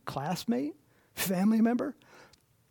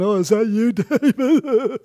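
higher, louder, laughing mock surprise; surprised Saul; 7 semitones higher, 9 dB louder than usual. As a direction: Saul hammed up, startled to hear David. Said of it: "Saul's going".

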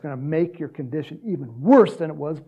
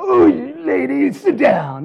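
loud, hammed-up telling-off; exaggerated loud rebuke; mock scolding; 9 semitones higher, 9 dB louder, slower than usual. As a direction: order her down loudly, in mock annoyance. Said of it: "he didn't go".

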